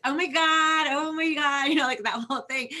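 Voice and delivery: put-on high, loud Valley Girl gush; Valley Girl accent. Guest imitates a Valley Girl for the host in Valley Girl accent. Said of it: "the whole Valley Girl thing".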